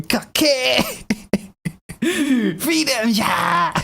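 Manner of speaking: mock otaku cheer, slow and high; stretched-out imitation of an excited otaku. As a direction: ham up a nerdy otaku fan cheering. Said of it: "stereotypical otaku voice".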